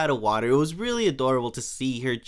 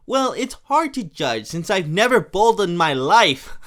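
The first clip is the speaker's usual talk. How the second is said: Marge retort; 4 semitones higher, 7 dB louder than usual. Retold or imitated. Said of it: "And she goes".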